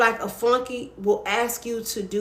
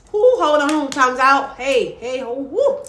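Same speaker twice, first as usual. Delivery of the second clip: excited halt; 4 semitones higher, 5 dB louder, slower than usual. Real, not acted. Loud excited halting.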